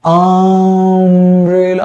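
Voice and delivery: अम्ब्रेला very slow with a long opening a; teacher stressing the vowel start; stretched vowel start. Shown as a demonstration. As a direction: draw out the first a in अम्ब्रेला. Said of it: "vowel sound".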